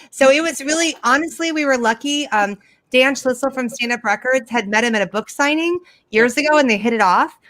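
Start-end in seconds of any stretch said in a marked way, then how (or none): none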